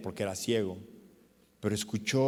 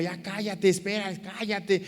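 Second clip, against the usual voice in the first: high-pitched mild scolding, not shouted; 8 semitones higher than usual. Acted. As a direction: hush him with raised pitch. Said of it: "Y la gente le regañaba".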